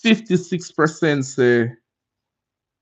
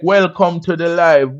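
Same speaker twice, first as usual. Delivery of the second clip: ordinary talk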